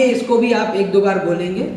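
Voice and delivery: ordinary talk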